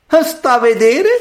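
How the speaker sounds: put-on high, slow, haughty girl. A narrator's reading. Proud daughter answering the great lady, haughtily. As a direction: sneer from on high, drawn out. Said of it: "rispose la superba".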